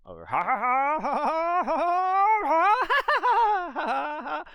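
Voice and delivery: very high, loud, shrieking ha-has; wild laughter. Shown as a demonstration. A big escalating laugh for the laughter yoga game.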